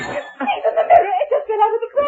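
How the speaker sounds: high, tearful panic; crying out as the mirror falls; panicked cry. Acted. Mirror falls during haunting.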